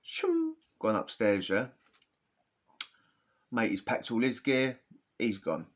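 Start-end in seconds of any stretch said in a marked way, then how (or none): 0.0-0.5 whoosh noise. Retold or imitated. Quick exit sound.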